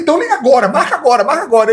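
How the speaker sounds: retold eager urging; urgent. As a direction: push him to call right away. Urging friend to call now.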